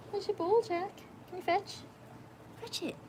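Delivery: high coaxing voice to the dog; dog-talk voice. Real, not acted. Owner urging Jack to fetch, high and coaxing.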